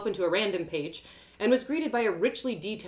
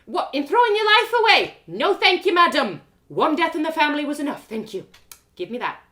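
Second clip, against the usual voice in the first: much higher and louder angry outburst; furious mum; 9 semitones higher, 11 dB louder than usual. Acted. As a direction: blow up at the daughter. Mum explodes at her daughter.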